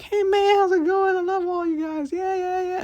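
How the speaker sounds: squeaky, very high and loud happy-go-lucky dude; cartoonishly upbeat, pitch way up in a silly put-on voice; mock chipper Blazkowicz. Reborn Blazkowicz greeting everyone in a hammed-up super high chipper voice. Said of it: "is now like".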